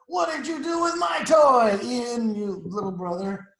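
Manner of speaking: high, loud demand at a little brother; hammed-up cross big sister; accusing shout. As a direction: cry out the question, then ease down.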